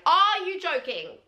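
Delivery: high, slow, stunned exclamation; gobsmacked. Retold or imitated. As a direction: stretch it out in utter disbelief. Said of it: "I was literally gobsmacked".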